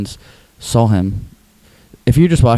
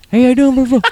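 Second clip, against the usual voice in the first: very high greeting, mimicked; put-on high, over-eager call; Tim impression; 4 dB louder than usual. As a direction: imitate him hailing from the window. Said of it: "he talks like this".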